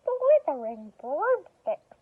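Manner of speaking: loud, high, slow exasperated cry; witch's shout. Acted. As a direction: bellow it as the witch in dismay. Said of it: "shouted Winnie".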